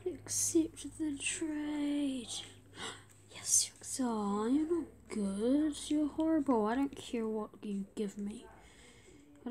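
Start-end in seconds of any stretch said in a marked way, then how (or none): none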